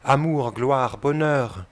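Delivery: high, loud, impassioned call; fervent lookout's shout, pitched well up; ardent, wild cry. A narrator's reading. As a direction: cry it out from the masthead, voice lifted. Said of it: "ardente et folle crie".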